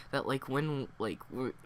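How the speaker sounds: normal talking voice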